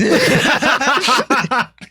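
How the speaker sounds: high, bursting laugh; loud laugh. Real, not acted. Guest laughing at his own punchline, loud high laugh. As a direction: crack up loudly at the joke.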